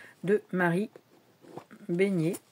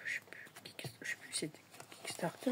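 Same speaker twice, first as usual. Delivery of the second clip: whisper; 13 dB quieter, faster than usual. Real, not acted. Trying to remember crowdfunding site.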